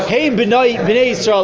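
ordinary delivery

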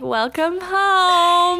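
high, very drawn-out greeting; mock welcome. Roommates greeting one back from a month away, mock welcome.

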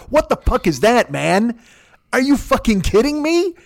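high, disbelieving outburst; can't believe it, rising up; incredulous. Real, not acted. Complaining about cat food.

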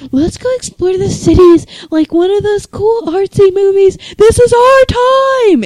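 high, loud, dreamy excitement; giddy shout. Pretend. Imitating drunk Rainey's idea.

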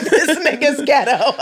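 high, drawn-out cry through laughter; laughing exclamation. Retold or imitated. Comic repeating her reaction to the commercial, laughing exclamation. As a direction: crack up while exclaiming in mock disbelief.

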